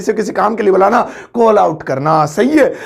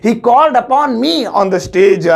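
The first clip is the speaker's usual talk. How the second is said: slow careful English; much slower than usual. Shown as a demonstration. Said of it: "so English होगी".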